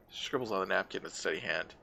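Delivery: ordinary talk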